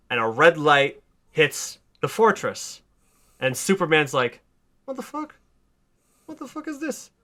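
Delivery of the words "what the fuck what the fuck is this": soft, high, breathless disbelief; stunned hush. Retold or imitated. Superman stunned as the red sun hits. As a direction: gasp it out under your breath.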